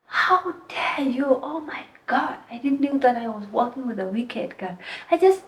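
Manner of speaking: high, indignant imitation of a scolding; retold insulting tirade. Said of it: "Wow, insulting you".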